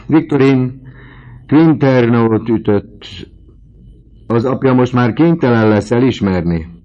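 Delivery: high, loud burst of excitement; excited exclaiming. A narrator's reading. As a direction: proclaim the windfall at full voice. Landlady exclaims over Victorine's windfall.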